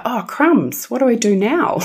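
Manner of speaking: high, panicky "oh crumbs"; suddenly lost and a bit alarmed; flustered surprise. Retold or imitated. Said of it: "they're like".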